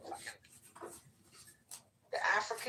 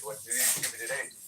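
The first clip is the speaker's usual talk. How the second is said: put-on African accent; 2 semitones lower, much faster than usual. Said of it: "the representative was an African".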